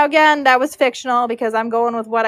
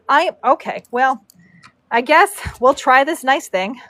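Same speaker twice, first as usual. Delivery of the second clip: ordinary delivery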